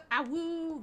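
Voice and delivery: wolf voice. Pretend. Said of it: "That's a sound that vampires make".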